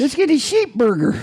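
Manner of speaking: sheep burger said high and drawled; hammed-up country voice; country mispronunciation. Couple joking each time they pass a store, in a high mock country voice. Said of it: "we say".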